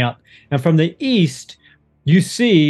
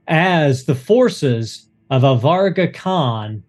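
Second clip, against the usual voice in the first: normal talking voice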